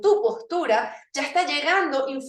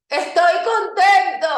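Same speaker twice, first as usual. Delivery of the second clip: higher, louder shout of "Estoy contento"; shouted happy line; 6 semitones higher, 9 dB louder than usual. Shown as a demonstration. Coach urges class, shouting the happy line. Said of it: "Díganlo".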